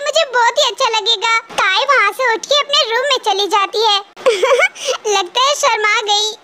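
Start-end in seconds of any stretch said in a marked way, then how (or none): 4.2-5.1 a short pleased laugh; amused chuckle. Acted. Mother-in-law chuckles as the bride runs off, amused chuckle. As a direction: chuckle at the shy girl. Jin laughs as Tae leaves.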